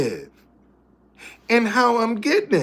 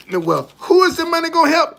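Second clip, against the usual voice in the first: high and loud nosy challenge; mock questioning voice; 8 semitones higher, 5 dB louder, faster than usual. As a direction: mimic a suspicious giver demanding answers. Quoting people who question giving.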